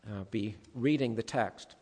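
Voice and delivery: usual speaking voice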